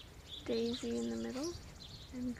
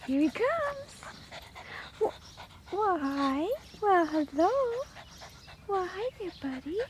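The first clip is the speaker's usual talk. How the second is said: much higher, louder puppy voice; squeaky praising a dog; 7 semitones higher, 6 dB louder, slower than usual. Real, not acted. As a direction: coo a hello to the puppy. Greeting the puppy.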